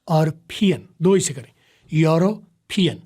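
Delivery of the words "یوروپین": "یوروپین" drawn out with a puffed p; slow pronunciation. Shown as a demonstration. Teacher drilling the word for students. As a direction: say "یوروپین" slowly, popping the p. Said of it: "پی blast کر دیا".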